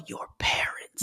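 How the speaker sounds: unvoiced whisper. Real, not acted. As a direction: breathe it under your breath. Whispered aside about parents.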